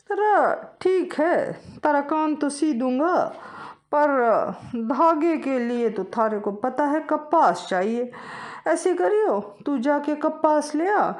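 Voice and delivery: high, loud, slow village weaver; slow, loud rustic weaver voice. Acted. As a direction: drawl it out like a country weaver.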